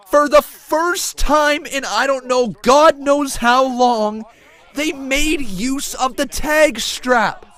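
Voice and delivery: loud, high, drawn-out exclamations; excited shouted play-by-play. Real, not acted. Commentator thrilled the tag strap is finally used, excited shouting. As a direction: shout in jubilant disbelief.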